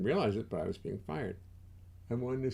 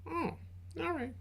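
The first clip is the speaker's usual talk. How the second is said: normal talking voice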